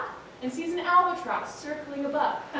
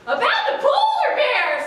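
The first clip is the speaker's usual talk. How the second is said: very high, loud bird voice; high screechy albatross; 12 semitones higher, 8 dB louder than usual. A narrator's reading. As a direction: call down from the sky. Albatross voice in story.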